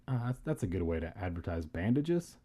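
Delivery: ordinary delivery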